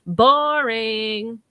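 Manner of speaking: a hint of a bored, sing-song spirit; sing-songy voice. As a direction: lilt it like a bored child. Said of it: "disembodied sing-songy voice".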